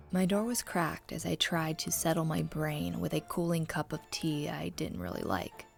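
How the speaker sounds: plain reading voice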